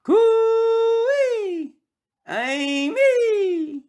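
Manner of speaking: high, long hollering call; calling out coo-ee. Acted. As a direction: call out far across the yard. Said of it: "he called to her across the room".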